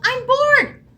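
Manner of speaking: high, loud squawk of a bored hen. Acted. Bored hen squawks to the barnyard animals, high and loud. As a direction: squawk it out like a fed-up chicken. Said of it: "the hen squawked".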